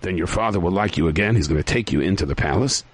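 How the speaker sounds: ordinary delivery, nothing marked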